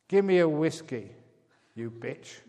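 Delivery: loud rude bark of an order; barked demand. Retold or imitated. Parrot barking at the flight attendant for whiskey, loud and rude. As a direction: snap the drink order at the attendant. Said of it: "the parrot barked".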